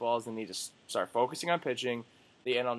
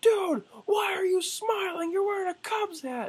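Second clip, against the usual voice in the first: mock upset fan. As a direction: ham up a whiny shocked fan in a high squeal. Angry fan mocked in a retort.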